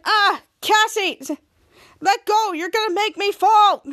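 high, loud, drawn-out plea; acted alarm. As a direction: cry out as if about to fall. Dangling man pleads with Cassie.

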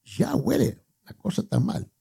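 high-pitched, slow whiny complaint; Martha complaining. Acted. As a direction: play Martha, fretting and protesting to Jesus. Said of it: "le dijo".

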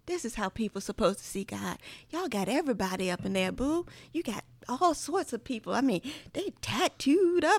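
animated Southern storytelling voice, rising as it goes; dad's Southern accent. Retold or imitated. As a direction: imitate dad's country accent, building to amusement. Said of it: "very Southern accent".

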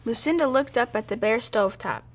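plain reading voice

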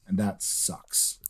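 breathy, slow, defeated whisper. Real, not acted. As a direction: mutter it under your breath. Dejected aside about losing.

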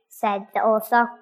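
ordinary delivery, nothing marked

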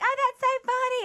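high mock gush. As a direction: squeal it up high. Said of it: "[name]'s like".

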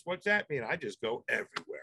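ordinary talk, nothing marked

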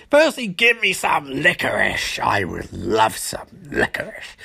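mock-polite villain, dropping low at the end; put-on villain voice. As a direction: purr the request like a smug villain.

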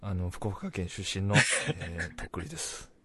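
low, soft, bashful mock formality; play-acted timid politeness, voice dropped; humble self-introduction. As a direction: introduce yourself meekly, as if starstruck.

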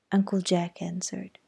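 plain reading voice

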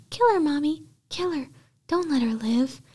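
put-on high, thin little boy's voice urging mother; high-pitched child voice. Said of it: "in a high-pitched voice says".

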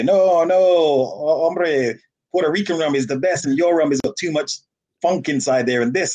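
put-on a touch of Puerto Rican accent. Puerto Rican guest arguing that his rum is best, lightly mocked Puerto Rican accent. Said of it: "someone from Puerto Rico come in there and say".

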